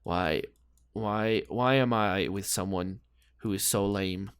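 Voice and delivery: low, soft mock lament; imitating a disappointed girlfriend. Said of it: "she can actually look down and be like".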